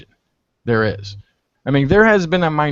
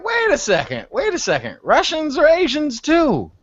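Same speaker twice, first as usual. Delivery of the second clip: pitched up, exasperated objection; building from puzzled to insistent, higher; arguing with his friend, exasperated and high; mock exasperated; 10 semitones higher than usual. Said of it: "I'm like".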